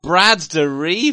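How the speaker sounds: high, slow drawled surfer voice; surfer dude voice. Pretend. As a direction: stretch it like a stoner surfer. Mocking the surfer-sounding name.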